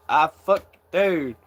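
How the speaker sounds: ordinary delivery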